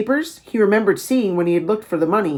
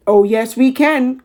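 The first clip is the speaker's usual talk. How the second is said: smiling; 5 semitones higher, 3 dB louder, slower than usual. A narrator's reading.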